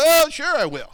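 high, loud, breezy mock agreement; mock eager promise. Preacher mimicking an eager empty promise, high and loud. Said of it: "You go".

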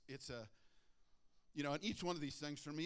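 ordinary delivery

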